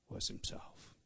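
dropped to a near whisper, low; hushed. Real, not acted. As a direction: let the words fall away softly. Hushed climax of the point.

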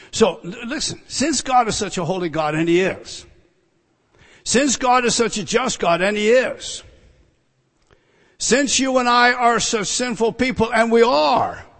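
loud, high preaching, building with each clause; impassioned preaching. Real, not acted. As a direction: drive the argument home from the pulpit.

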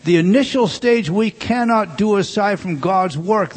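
usual speaking voice

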